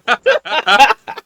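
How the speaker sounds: loud, high-pitched laugh; burst of laughter. Real, not acted. Cartman joke sets off laughter.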